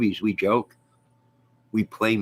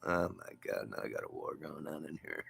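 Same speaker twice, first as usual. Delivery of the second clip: much softer, dismayed boxer mumble; quiet, weary aside in character; Stallone; 4 semitones lower, 15 dB quieter than usual. Acted. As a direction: grumble to yourself as the movie boxer.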